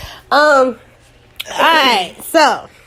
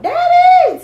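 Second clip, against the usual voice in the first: high, loud call of "Daddy!"; 6 dB louder than usual. Retold or imitated. Daughter calling her father at home, bright loud call. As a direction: yell for him across the house.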